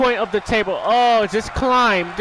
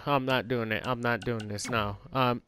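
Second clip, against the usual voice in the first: low, much softer resigned muttering; quiet muttering; 12 semitones lower, 12 dB quieter than usual. Real, not acted. Gamer muttering to himself as he gives up, low and soft. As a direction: mumble it to yourself, giving up.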